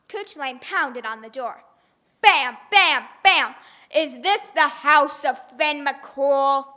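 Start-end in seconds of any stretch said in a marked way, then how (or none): 2.2-3.6 booming bam bam bam; thunderous knocking; loud knocks. A narrator's reading. 3.9-6.7 slow, loud giant voice; a big giant demanding at the door; slow loud giant's question; booming giant. Acted.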